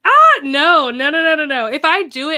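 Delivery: loud, high burst of rapid no's; emphatic playful refusal. Real, not acted. Streamer refusing to do a British accent, loud playful protest.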